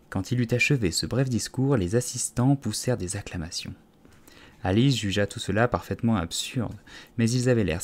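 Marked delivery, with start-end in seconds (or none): none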